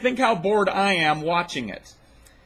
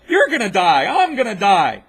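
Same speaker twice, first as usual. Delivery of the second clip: exasperated loud rant; 7 dB louder than usual. Real, not acted. Ranting at the video maker.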